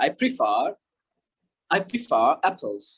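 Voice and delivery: slow, light linking R in "prefer apples"; half-pronounced R. Shown as a demonstration. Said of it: "আরটা কিন্তু পুরোপুরি উচ্চারণ হইল না". ("prefer"-এর র হালকা, ধীরে)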